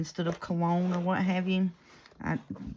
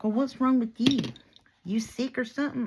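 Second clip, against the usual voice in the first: higher-pitched mock challenge; retold indignant customer; 8 semitones higher, 4 dB louder than usual. Perfumed customer questioning the wheezing clerk, mock indignation. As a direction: raise the pitch, question them sharply.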